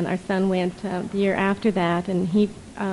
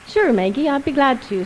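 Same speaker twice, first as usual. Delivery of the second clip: Irish brogue; 5 semitones higher, 4 dB louder than usual. Acted. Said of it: "in a not a very good Irish brogue".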